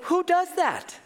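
very high, incredulous retort; high-pitched mock outrage; appalled disbelief. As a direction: act out shocked disbelief, voice way up. Acts own shocked reaction.

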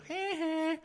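high, taunting little heh heh; high mocking snicker at the stuck driver; mocking laugh. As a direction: gloat at her from the car. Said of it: "give her the".

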